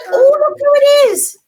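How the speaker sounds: high, loud, fast gasp of delight; hammed-up surprise at the puppet; mock surprise. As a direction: pretend to be amazed for the kids. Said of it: "surprised puppet reveal for kids".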